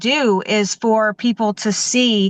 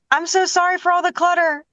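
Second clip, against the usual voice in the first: pitched-up, lightly voiced apologetic homeowner; apologetic client; 9 semitones higher than usual. Organizer imitating a client apologizing for clutter, pitched-up apologetic voice. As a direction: mimic a sheepish client apologizing, voice raised. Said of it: "will say".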